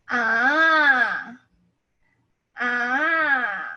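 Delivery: long "Ah" with a turning point in its pitch; third tone. Shown as a demonstration. Teacher moves body to show the tone, long bending "Ah". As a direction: stretch "Ah" and bend it like a wave. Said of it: "the third tone".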